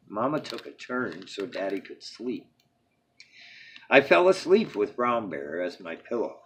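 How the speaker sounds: usual speaking voice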